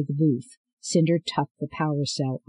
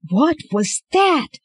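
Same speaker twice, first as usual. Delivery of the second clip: high, loud, drawn-out yell; slow, high-pitched shout of alarm; 13 semitones higher, 6 dB louder, slower than usual. A narrator's reading. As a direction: yell it in disbelief, stretching the words. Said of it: "Thorne yelled as he peeled".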